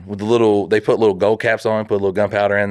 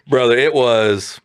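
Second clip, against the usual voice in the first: usual speaking voice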